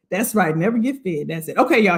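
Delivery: ordinary talk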